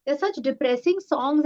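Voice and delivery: imitating a mother's protest, high-pitched; disapproving mother. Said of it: "And she was like".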